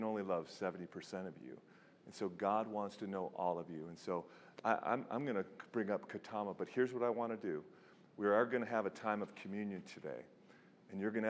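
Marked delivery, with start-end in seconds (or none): none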